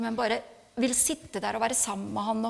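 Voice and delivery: ordinary talk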